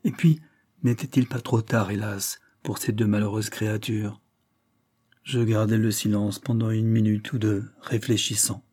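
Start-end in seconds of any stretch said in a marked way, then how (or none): none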